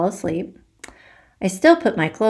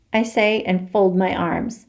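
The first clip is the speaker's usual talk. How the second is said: usual speaking voice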